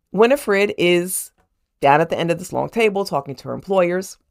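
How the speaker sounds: ordinary delivery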